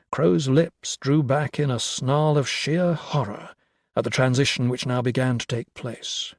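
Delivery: ordinary delivery, nothing marked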